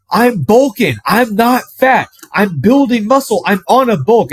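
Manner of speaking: high defensive whine. Retold or imitated. Grandson insisting to grandparents he is bulking, high whiny protest.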